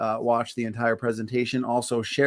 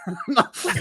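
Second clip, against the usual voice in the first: laugh; 10 semitones higher than usual. Real, not acted. Confused by joke.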